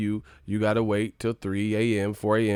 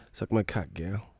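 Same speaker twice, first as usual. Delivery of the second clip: normal talking voice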